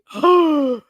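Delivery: loud sharp mock gasp; shocked gasp. Reacting to his nerve, shocked gasp. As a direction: gasp in outrage.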